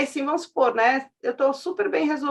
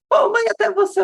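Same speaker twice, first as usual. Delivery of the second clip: put-on higher, louder protest of a stung little boy; hurt son; 9 semitones higher, 7 dB louder than usual. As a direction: complain like a kid let down by mom. Son protests to his mother.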